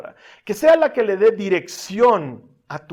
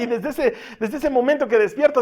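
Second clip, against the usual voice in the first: ordinary delivery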